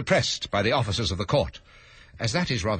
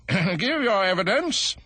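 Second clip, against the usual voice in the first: king voice; 12 semitones higher, 3 dB louder, slower than usual. Acted.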